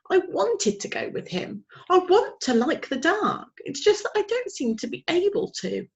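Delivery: put-on high young owl voice, longing; a little owl wishing he were braver; small owlet, wistful. A narrator's reading. Plop telling his mother he wants to like the dark, high.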